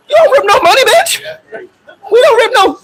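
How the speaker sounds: high-pitched outraged yell; going off on her. Retold or imitated. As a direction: blow up at her over the ripped money. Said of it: "Here's why I'm about to go off on her".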